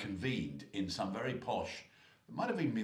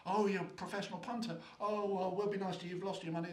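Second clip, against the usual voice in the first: much higher, fluting sympathetic ladies; mock cooing ladies; 11 semitones higher than usual. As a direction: coo like impressed pub ladies. Ladies answer, impressed.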